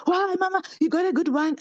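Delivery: high, light voice of a grown daughter questioning; daughter's voice. Retold or imitated. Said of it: "And she's like".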